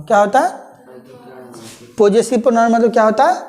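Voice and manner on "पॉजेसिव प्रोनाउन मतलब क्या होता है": normal talking voice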